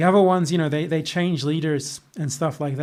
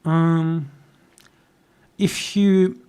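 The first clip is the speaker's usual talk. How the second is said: ordinary talk, nothing marked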